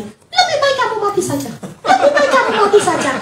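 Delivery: high squawky parrot repeating a curse. Acted. Preacher mimics the pet parrot squawking the curse. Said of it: "burung beo itu ngomongnya".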